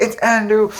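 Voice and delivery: high, thrilled fan squeal; reliving a fan moment, high and excited; excited shout. Retold or imitated. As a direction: blurt it out like you just spotted your hero. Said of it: "I was going crazy".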